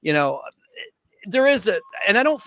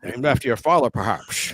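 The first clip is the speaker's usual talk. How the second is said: low, dry Scottish purr fading to a hush; deadpan Connery line, dropping low and soft; Sean Connery impression; 7 semitones lower, 3 dB quieter than usual. Host doing Sean Connery's Scottish quip. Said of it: "I just love Sean Connery when he goes".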